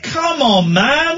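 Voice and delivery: high-pitched shouted appeal; shouting out the window. Retold or imitated. Driver challenging a bottle smasher across the road, shouting. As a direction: yell it from a car window. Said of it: "I started shouting out of the window".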